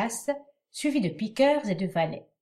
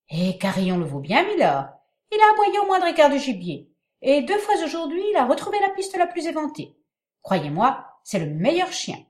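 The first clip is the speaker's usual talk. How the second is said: higher, eager huntsman; 8 semitones higher, 7 dB louder, faster than usual. A narrator's reading. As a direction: boast about the hound. Huntsman voiced in play.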